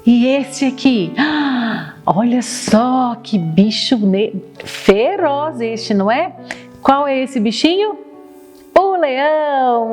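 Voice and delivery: put-on slow, sing-song toddler voice; reading to a toddler. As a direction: draw out each word for little kids. Quiz with toddlers about animals.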